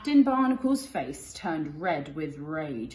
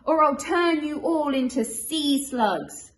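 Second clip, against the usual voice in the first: loud, higher, slow command; bellowing Pete; 8 semitones higher, 8 dB louder, slower than usual. Acted. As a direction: chase the bandits off with a bellow. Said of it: "he bellowed".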